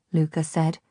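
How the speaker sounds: plain reading voice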